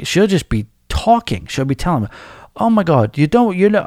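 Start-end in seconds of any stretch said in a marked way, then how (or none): 2.6-3.8 higher-pitched attempt at Irish; lilting mock-Irish, pitched up; bad Irish accent. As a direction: imitate an Irish assistant manager, badly. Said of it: "I can't do an Irish accent".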